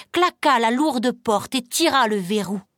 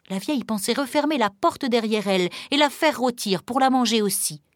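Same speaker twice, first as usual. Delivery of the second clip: ordinary delivery, nothing marked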